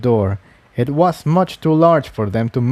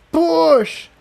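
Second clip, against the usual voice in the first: much higher, forced out with effort through gritted teeth; strained grunt. Acted. Incendia straining at the door with magic, grunting through gritted teeth. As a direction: grit your teeth and shove the word out. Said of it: "Incendia grunted through gritted teeth".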